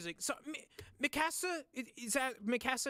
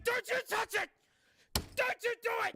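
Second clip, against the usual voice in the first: mock desperate shout; 13 semitones higher than usual. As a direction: shout a frantic over-the-top plea. Repeats the plea after calming.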